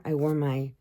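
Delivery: normal talking voice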